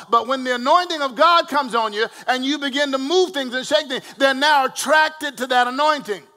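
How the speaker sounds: loud, rising preacher cadence; preaching. Acted. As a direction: preach it to the congregation. Preaching at full force.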